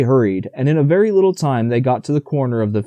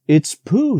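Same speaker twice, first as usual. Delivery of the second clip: higher, eager exclamation; excited; 7 semitones higher than usual. A narrator's reading. Boy spotting his friend Pooh, higher and eager. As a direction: blurt it out with a lift of joy. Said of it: "said Christopher Robin excitedly".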